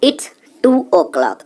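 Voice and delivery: slow model phrase. Shown as a demonstration. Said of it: "teacher models English time".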